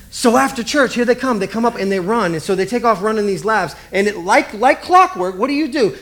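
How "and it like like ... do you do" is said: usual speaking voice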